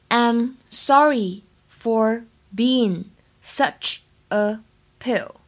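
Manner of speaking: drawn out slowly, word by word; slow model sentence for students; very slow teaching read. Shown as a demonstration. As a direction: stretch it out so learners can follow. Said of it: "整句话的发音技巧呢".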